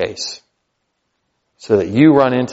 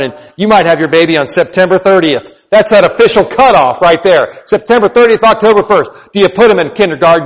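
loud, higher preaching push; full-force preaching; 4 semitones higher, 5 dB louder than usual. Acted. Pastor pressing parents on the kindergarten decision, loud preaching. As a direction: press the point hard on the crowd.

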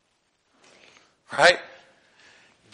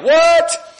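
baffled what; 9 semitones higher than usual. Retold or imitated. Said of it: "it's just kinda like".

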